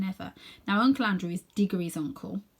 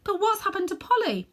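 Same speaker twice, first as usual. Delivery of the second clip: put-on worried boy; 13 semitones higher, 4 dB louder than usual. Boy worried about vanished friend.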